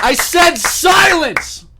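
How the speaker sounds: high, very loud, drawn-out bellow; mock-outraged shout. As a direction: roar for silence in mock fury. Mock demand for a moment's silence.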